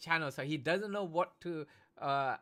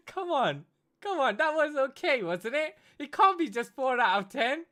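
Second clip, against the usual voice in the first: louder, higher, half-laughing protest; 11 semitones higher, 9 dB louder than usual. Real, not acted. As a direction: plead for a better score, laughing. Protesting the low score.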